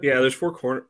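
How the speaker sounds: ordinary talk, nothing marked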